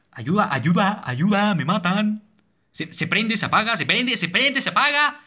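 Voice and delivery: high, loud, frantic repetition; panicked cry for help. Real, not acted. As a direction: build to a high-pitched panic. Cries for help under attack.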